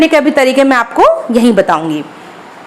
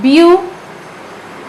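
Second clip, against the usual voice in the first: beautiful in three pieces, beau-ti-ful; syllable by syllable; 3 semitones higher, 3 dB quieter, much slower than usual. Shown as a demonstration. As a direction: say beautiful very slowly with long gaps between syllables. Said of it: "तीन बार रुके हम इसको बोलने में". (beautiful को तीन बार रुक कर)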